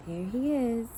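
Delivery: ordinary delivery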